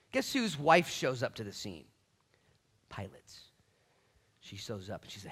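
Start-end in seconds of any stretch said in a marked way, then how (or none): none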